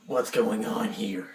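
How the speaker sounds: slow, rough smoker's voice; mock chain-smoker in charge; heavy smoker woman. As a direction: croak it out like four packs a day. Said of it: "She sounds like she smokes, like, four packs a day".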